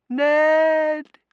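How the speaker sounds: high, loud drawn-out cry; calling out. A narrator's reading. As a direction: call his name from far away. Woman calls out from far off.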